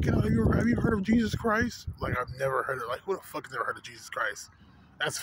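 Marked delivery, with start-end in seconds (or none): 0.0-1.9 higher-pitched mock lady's plea; imitated pleading lady. Lady stops him on his way to work, mock pleading. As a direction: imitate an upset woman, pitched well up. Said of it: "some lady and shit starts crying".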